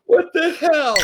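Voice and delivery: high, slow, half-laughing half-crying disbelief; laughing through tears. Pretend. Host imagines finding rare whiskey, laughing and mock crying. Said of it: "weep openly".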